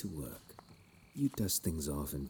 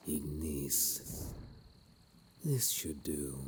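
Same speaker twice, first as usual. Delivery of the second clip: ordinary delivery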